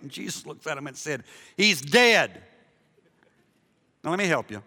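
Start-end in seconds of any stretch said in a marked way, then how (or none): 1.6-2.4 loud, punchy mock-blunt retort; blunt announcement. Preacher voices Jesus bluntly correcting the disciples, loud and blunt. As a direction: deliver the acted line loud and emphatic.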